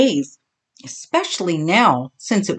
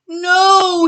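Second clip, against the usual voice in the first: high, loud distressed no; crying out; 11 semitones higher, 5 dB louder than usual. Acted. Bully answering Skeeter, high distressed cry. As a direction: wail it in pain and panic. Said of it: "he cried".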